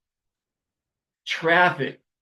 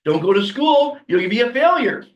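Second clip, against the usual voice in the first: scolding parent; 5 semitones higher, 6 dB louder than usual. Retold or imitated. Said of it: "the parents teach you".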